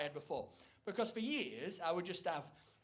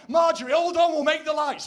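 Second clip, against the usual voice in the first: much louder and higher, thrilled shout; excited driver; 9 semitones higher, 17 dB louder than usual. Retold or imitated. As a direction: yell to your wife as you floor it. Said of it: "So it's like".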